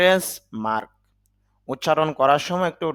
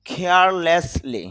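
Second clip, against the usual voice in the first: Carelessly spelled out slowly with a breathy kh; drawn-out teacher model of carelessly; slow dictation-style carelessly, aspirated kh; slow clear model word; 3 semitones higher, 4 dB louder, much slower than usual. Shown as a demonstration. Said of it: "খেয়াল করেন".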